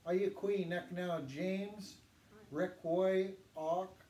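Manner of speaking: slow, careful Yurok introduction; teacher modelling for the class, slowly; slow model phrase. Shown as a demonstration. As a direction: say it slowly so they can repeat. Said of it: "I'm gonna say it".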